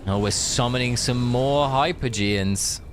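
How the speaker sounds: ordinary talk, nothing marked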